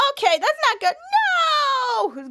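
high, rising to a squealing no; mock dismay. Charlotte sees the dandruff villain coming toward her and squeals in mock dismay. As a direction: build from worry into a squeal.

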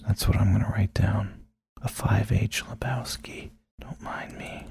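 whispered aside to herself. Real, not acted. Jotting down a note in a whisper. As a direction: murmur it as a private note.

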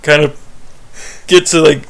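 ordinary talk, nothing marked

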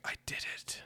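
breathless whisper of triumph; exhausted whisper. Retold or imitated. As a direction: barely breathe the words out. Dying Sam holds up loop.

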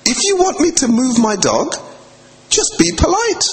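high, firm, polite telling-off; firm but polite. Retold or imitated. Telling the rude man to be polite, high and firm. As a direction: correct him politely but firmly. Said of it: "I said".

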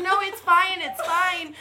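mock-cheery it's fine; forced reassurance. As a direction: insist it's fine when it isn't. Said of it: "I was like".

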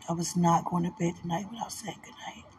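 soft breathy whisper. Real, not acted. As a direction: whisper it close to the mic. Whispered goodnight, unvoiced.

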